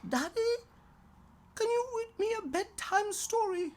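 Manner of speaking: put-on high, quick child's voice asking a favour; pleading little girl. Daughter in bed asking daddy for a story, high and hopeful. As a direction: beg for a story like a small girl.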